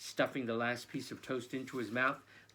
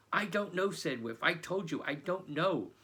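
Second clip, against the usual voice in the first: exasperated; 5 semitones higher, 4 dB louder than usual. Acted. Pressed, repeats himself.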